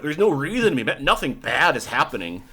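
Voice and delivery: ordinary talk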